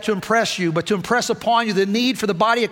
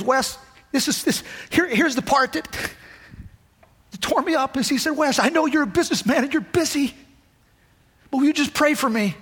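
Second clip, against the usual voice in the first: choked up; 6 semitones higher than usual. Real, not acted. Preacher retelling a friend's plea for prayer, choked up. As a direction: voice high and breaking with real emotion.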